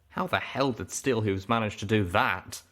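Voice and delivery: ordinary delivery, nothing marked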